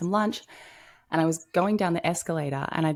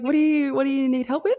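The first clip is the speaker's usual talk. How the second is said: high, loud eager question; retold eager helper; eager offer; 11 semitones higher, 6 dB louder than usual. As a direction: brightly offer help. Retelling offering help.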